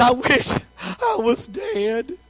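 slow, drawn-out whiny lament; mock self-pity. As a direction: act out a sulking prophet's complaint, slowly. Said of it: "And he said".

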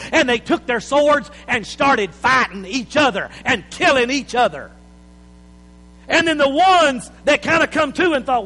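loud, high, driving sermon climax; preaching at full force. Acted.

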